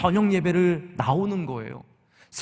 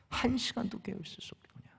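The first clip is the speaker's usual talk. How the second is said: hushed, breathy whisper, much softer; 5 semitones higher, 12 dB quieter than usual. Acted. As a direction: whisper the rebuke as if leaning in close. Quotes Jesus, then repeats it whispered. (속삭이듯 조용히)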